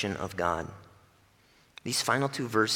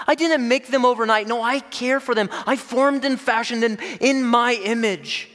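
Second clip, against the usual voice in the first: much higher and louder, pleading rebuke; God rebuking; 11 semitones higher, 7 dB louder than usual. Acted. God challenges Jonah about the plant, high and impassioned. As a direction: challenge him, rising to a cry. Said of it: "God is saying".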